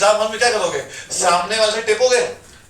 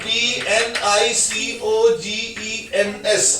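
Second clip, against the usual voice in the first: spelling out slowly; 4 dB quieter, much slower than usual. Shown as a demonstration. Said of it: "पी silent होता है".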